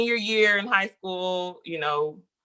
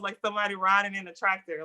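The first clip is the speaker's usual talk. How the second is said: normal talking voice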